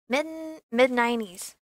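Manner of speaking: ordinary delivery, nothing marked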